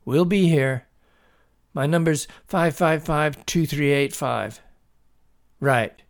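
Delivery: father phoning police. A narrator's reading. Father reporting his son's find to police, businesslike. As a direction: report it businesslike, pausing for answers. Father on emergency phone call.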